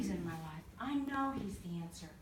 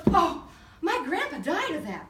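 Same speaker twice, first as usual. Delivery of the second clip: waitress voice; 7 semitones higher, 11 dB louder, slower than usual. Retold or imitated. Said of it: "And she goes".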